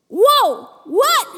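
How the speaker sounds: high, loud astonished whoa then what; stunned wonder at something amazing; awed surprise. Acted. Believers seeing what Jesus does, amazed. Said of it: "It is filled with awe".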